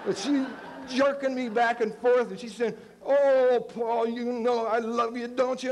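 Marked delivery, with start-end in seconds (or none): 3.0-5.7 doting schoolteacher crooning to a pupil; teacher's voice. Retold or imitated. Teacher voiced in his story.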